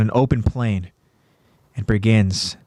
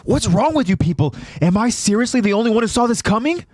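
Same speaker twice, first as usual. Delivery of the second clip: high-pitched indignant outburst; acted irritation, quickening; exasperated; 11 semitones higher, faster than usual. A narrator's reading. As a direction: scold the others in disbelief. Jean berates the others while chasing.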